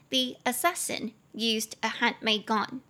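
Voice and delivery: slow, careful English model sentence; slow model reading. Shown as a demonstration. Teacher reading the model sentence aloud to students, slow and clear. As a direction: read it slowly and clearly for learners. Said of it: "我们来从头看".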